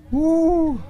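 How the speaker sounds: high excited whoop; excited woo. Real, not acted. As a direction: let out a happy cheer. Cheering a big catch.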